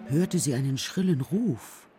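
ordinary talk, nothing marked

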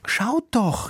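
put-on high-pitched little girl's call; child calling out. A narrator's reading. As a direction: urge the parents to look. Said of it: "rief das Mädchen".

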